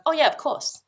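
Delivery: high, loud mock-obvious agreement; cousin's breezy certainty. Imitating cousin confirming the childhood signs, high and emphatic. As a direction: imitate someone finding it totally obvious.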